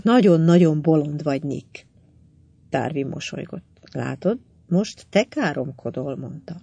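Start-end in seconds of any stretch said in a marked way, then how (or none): none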